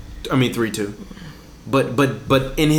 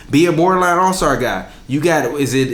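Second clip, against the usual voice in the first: ordinary talk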